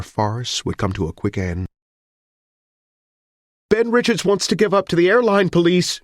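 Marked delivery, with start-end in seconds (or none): 3.7-6.0 high, loud call to the police; crying out. Acted. Amelia shouts the surrender to the police, loud and high. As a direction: shout it out across the lot. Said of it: "she cried".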